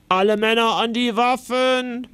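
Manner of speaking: rally the men with a firm order; lightly voiced command. A narrator's reading. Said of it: "befiehlt Ritter Kuno".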